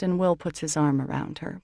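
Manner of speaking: plain reading voice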